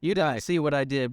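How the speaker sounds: normal talking voice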